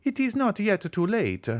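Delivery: keen to step in, lightly voiced; eager. A narrator's reading. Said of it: "cried Bertuccio, uh, eagerly".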